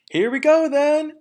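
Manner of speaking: high, loud eager shout. Acted. Bean shouting to the others as the tractors roll in, loud and high. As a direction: yell it out as the tractor starts. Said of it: "shouted Bean".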